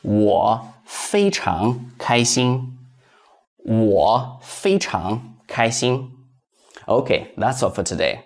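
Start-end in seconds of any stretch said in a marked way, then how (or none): 0.0-6.1 slow, careful Mandarin for learners; slow teacher's demo; slow model sentence. Shown as a demonstration. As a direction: say it slowly, syllable by syllable, for students to copy. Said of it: "So that would be".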